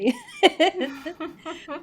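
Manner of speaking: amused burst of laughter; real laugh. Real, not acted. Laughs after quote.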